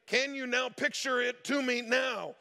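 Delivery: imitated exasperation, high-pitched; mock frustration. Scammer demands the picture from staffer, mock frustrated. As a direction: act out a scammer losing patience. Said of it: "He's getting frustrated".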